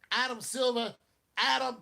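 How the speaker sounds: loud, high summons; calling out. Pretend. Fan mock-summoning Adam Silver over a rigged series, calling out. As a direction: holler the name to get his attention.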